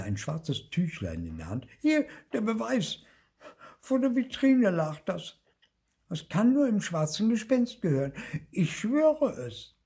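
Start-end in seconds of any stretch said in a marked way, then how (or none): 1.8-9.8 very high, loud little voice; piping, urgent protest; earnest little ghost, insistent. A narrator's reading.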